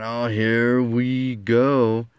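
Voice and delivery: very slow, stretched words; drawn-out anticipation. Retold or imitated. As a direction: stretch each word out in mock anticipation. Imagines she wants to date him.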